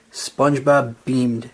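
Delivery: usual speaking voice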